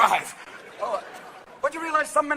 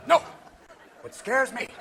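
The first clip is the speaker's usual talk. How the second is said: ordinary delivery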